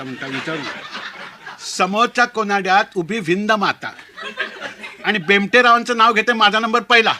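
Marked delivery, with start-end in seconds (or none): 1.6-7.1 bride's proud wedding-rhyme recital; sing-song announcement, rising in pitch and level; bride reciting rhyme. Acted. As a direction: declaim it like an earnest bride, building to the end. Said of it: "आमच्या बायकोनी उखाणा घ्यायला सुरुवात केली".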